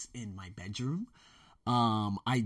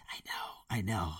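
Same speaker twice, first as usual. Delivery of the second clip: whisper; 2 semitones lower, 6 dB quieter than usual. Real, not acted. Answering viewers softly.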